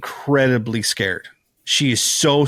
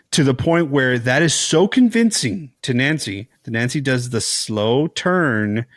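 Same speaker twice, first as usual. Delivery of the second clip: usual speaking voice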